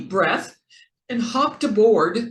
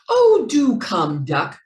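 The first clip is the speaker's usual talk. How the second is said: shouting; 4 semitones higher, 7 dB louder than usual. A narrator's reading. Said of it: "shouted Jack Rabbit".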